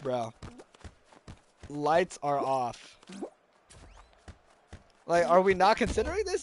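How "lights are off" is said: lights are off, each word spelled out slowly; slow emphatic repeat. Shown as a demonstration. Streamer to chat, spelling it out slowly and firmly. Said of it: "when I say lights are off".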